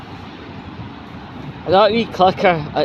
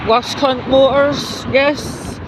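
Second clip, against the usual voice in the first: high, slow mocking drawl; sneering punchline; mocking nickname; 9 semitones higher, much slower than usual. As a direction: savour the insult slowly, pitched up. Punchline nickname for bus company.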